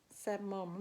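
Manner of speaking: ordinary delivery, nothing marked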